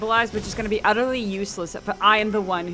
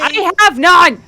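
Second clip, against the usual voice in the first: exasperated outcry; 8 semitones higher, 12 dB louder, slower than usual. Real, not acted. Player panics about no healing.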